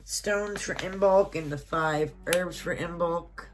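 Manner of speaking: ordinary talk, nothing marked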